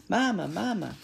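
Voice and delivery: put-on high, loud baby-bird cry for mama; little gosling voice, pitched high; peeping gosling. A narrator's reading. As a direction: peep it like a needy hatchling. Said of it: "peeped the gosling".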